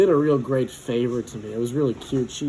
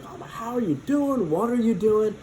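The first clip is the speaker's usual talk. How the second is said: put-on friendly old teacher voice; 8 semitones higher than usual. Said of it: "But she was, like".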